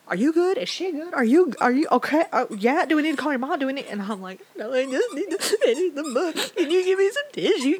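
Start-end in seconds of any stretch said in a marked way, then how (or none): 0.1-3.9 worried friends. Retold or imitated. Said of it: "they're like". 4.6-7.8 high, loud, teary laugh-cry. Retold or imitated. Reader laugh-crying to worried friends, asking for tissues. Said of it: "Like, I am a wreck".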